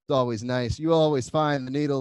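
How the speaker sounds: normal talking voice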